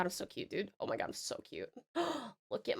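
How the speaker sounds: breathless whisper, so cute; hushed whisper of delight; whispered gushing. Real, not acted. Whispered gushing over a cute character design.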